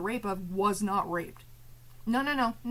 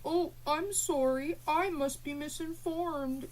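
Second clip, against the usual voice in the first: slow, higher mock-sorry whine; mock apology; 5 semitones higher, slower than usual. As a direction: mimic the chatter's fake apology, drawn out. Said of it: "it's like".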